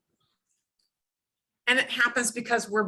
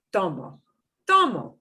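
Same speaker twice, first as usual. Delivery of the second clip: Tomo with the first syllable punched, falling away; stress on first syllable. Shown as a demonstration. Teacher showing students which syllable gets more punch, first-syllable stress on Tomo. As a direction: punch the TO in Tomo, let the end drop. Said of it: "So we punch this in more".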